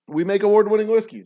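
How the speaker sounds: loud, high proud sales line; big pitch. Acted. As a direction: boast it to the crowd. Restarted pitch, louder.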